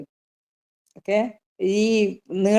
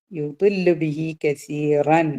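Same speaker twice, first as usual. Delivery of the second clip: slow, drawn-out Quranic chant; Quran recitation demo; 3 semitones lower, much slower than usual. Shown as a demonstration. Teacher reciting the verse for students, slow tajweed chant. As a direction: intone the verse slowly for the class. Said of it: "ഇവിടെ എന്തുണ്ട്?".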